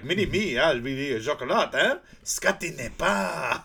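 bad French accent impression; mock French accent. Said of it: "He's like".

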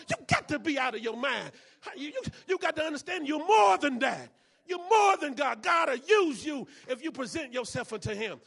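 full-voiced preaching cry; preaching shout. Real, not acted. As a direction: shout it out to the church.

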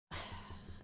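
exasperated breathy sigh; imitating the sound guy's fed-up sigh; put-upon sigh. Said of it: "And he was like".

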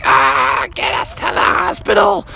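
high, strained falsetto wail; acted cry, FAST stretched out high; mom in labor. Mom in labor begs for hospital, hammed-up high wail. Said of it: "a little bit uncomfortable".

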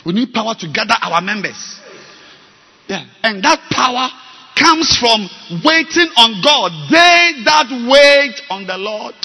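loud, high, worked-up preaching; shouting. Real, not acted. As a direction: lift it to a shout. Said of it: "Sorry for shouting".

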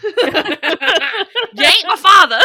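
high, loud, drawn-out mock yell; hammed-up outburst. Riffing on the word father, mock dramatic yell. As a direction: yell it through laughter.

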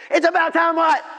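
high, loud indignant yell; acted furious comeback; angry retort. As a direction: shout the question back, outraged. David's acted angry retort to God.

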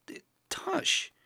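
breathless high whisper of "Tush"; shocked whisper. Acted. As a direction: gasp the name out in shock. Shocked at seeing silk.

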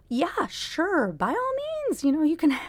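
high, over-eager retold "sure"; flustered mock agreement. Rattled niece humouring her uncle's request, flustered over-agreeing.

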